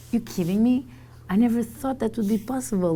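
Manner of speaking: can't believe the offer, voice jumps up; excited disbelief. Retold or imitated. Retelling the dream job offer, in high excited disbelief.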